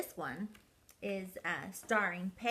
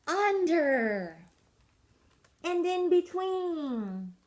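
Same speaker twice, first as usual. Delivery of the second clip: loud, higher shouts of over, under, in between; shouting; 9 semitones higher, 9 dB louder, much slower than usual. Acted. Peg and Cat shout directions while skiing after penguins. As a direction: yell each word down the slope. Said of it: "skied along shouting".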